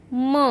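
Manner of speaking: म as an isolated "muh"; phonic M sound. Shown as a demonstration. Teacher showing the sound of M, म as "muh". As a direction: sound out म, not the letter name. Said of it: "यमचा उच्चार होतो".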